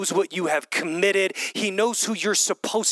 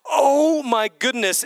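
slow, drawn-out wonder; amazed gasp of realization; 7 semitones higher, 6 dB louder, slower than usual. Retold or imitated. Worshipper realizing a hidden truth, slow amazed exclaim. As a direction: let it dawn on you, louder and higher. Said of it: "looking backwards and going".

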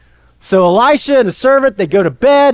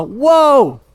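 awestruck; 4 semitones higher than usual. Acted. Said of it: "all of a sudden he saw".

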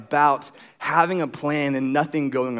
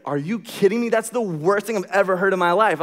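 higher-pitched retold complaint; exasperated disbelief; 5 semitones higher than usual. Customer reacting to the rental agent, exasperated. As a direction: protest as if you can't believe it.

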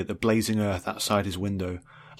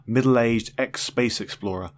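plain reading voice

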